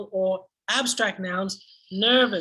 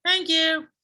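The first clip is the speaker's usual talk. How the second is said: high, louder chorused thanks; students' reply; 8 semitones higher, 5 dB louder than usual. Shown as a demonstration. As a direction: answer brightly like pupils. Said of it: "Lily and Josh".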